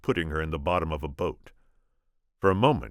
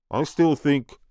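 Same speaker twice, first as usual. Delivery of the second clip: put-on booming French accent; 11 semitones higher, 8 dB louder, slower than usual. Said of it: "booming French accent of Andre the Giant".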